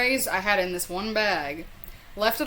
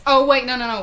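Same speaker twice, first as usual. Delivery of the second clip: sudden realization; 4 semitones higher, 7 dB louder, faster than usual. Real, not acted. Suddenly remembers, interrupts self.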